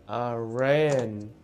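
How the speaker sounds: ordinary delivery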